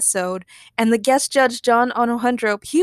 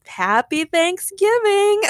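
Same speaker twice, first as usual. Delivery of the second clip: high, drawn-out holiday greeting; slow, high-pitched celebratory call; festive cheer; 10 semitones higher, 3 dB louder, much slower than usual. Real, not acted. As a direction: send out a big cheery holiday wish. Festive sign-off to listeners.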